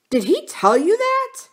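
very high, loud, incredulous question; indignant surprise. Acted. Husband asking about his brother, shocked and indignant. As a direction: demand it in disbelief, voice leaping up.

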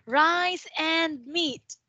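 slow, clear Rice and meat; slow careful model. Shown as a demonstration. Teacher slowly models the answer for Min. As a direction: say each word slowly for the learner. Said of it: "let's say meat".

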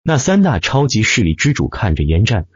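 plain reading voice

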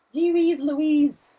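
loud, high, drawn-out exclamation; real post-scare outburst; rattled exclamation. Real, not acted. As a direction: exclaim it slowly and loudly to shake off a scare. Getting over a sudden scare.

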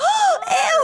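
sharp high gasp; real loud gasp; shocked gasp. Real, not acted. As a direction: gasp in horror. Reacting to the accident.

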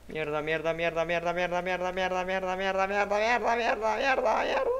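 frantic muttered swearing rising in pitch; cursing under breath. Real, not acted. Rushing build, timer running out.

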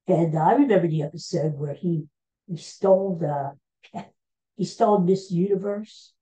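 ordinary talk

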